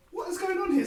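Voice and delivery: high baffled question; mock disbelief; incredulous. Reaction to the video.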